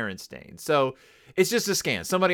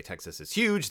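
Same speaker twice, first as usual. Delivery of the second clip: normal talking voice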